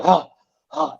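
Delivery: imitated winded huffs; two short mock gasps for air; out-of-breath panting. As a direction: huff like someone who just rushed in. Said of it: "out of breath".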